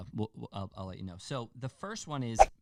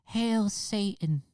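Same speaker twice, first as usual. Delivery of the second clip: high, slow mocking falsetto; wimpy high-voiced killer, hammed up; 10 semitones higher, 4 dB louder, much slower than usual. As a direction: mimic him with a long, high-pitched drawl. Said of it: "[name] type voice".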